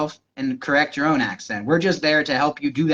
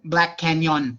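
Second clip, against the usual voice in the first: Thai accent; slower than usual. Shown as a demonstration. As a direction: say "Canyon" as can-YAWN, Thai accent, drawn out. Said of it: "use the Thai sound system to make the word".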